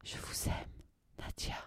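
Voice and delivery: soft breathy whisper of love; under his breath. A narrator's reading. Narrator whispering his secret to Nadia. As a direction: slip the words out in a whisper. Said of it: "je trouve le temps de prononcer".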